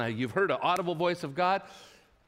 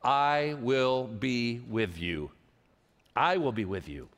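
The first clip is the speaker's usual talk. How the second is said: much lower and slow, I will be with you; slow, low reassurance; 5 semitones lower, slower than usual. Retold or imitated. God reassures Moses, low, slow and steady. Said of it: "All he's saying is".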